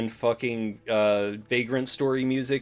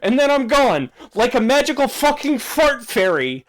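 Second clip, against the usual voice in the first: frustrated yell; 10 semitones higher, 7 dB louder than usual. Real, not acted. Frustrated rant about fixing everything.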